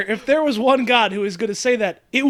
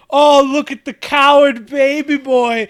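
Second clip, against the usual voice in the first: slow, loud, sneering taunt; taunting brat; 5 semitones higher, 6 dB louder, slower than usual. Retold or imitated. Mocking the tiny bully kid at the bar, slow loud sneer. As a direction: jeer at him like a cocky little punk. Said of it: "being like".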